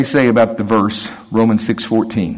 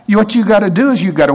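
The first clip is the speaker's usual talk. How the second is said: lively retort; 11 semitones higher than usual. Retold or imitated. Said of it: "And I said".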